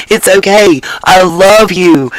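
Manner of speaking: usual speaking voice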